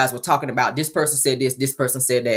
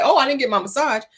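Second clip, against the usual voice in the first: high mimicking whine; mock her grievance in a raised pitch; mocking complaint; 8 semitones higher than usual. Calling out Tiffany over the massage, high mocking mimicry.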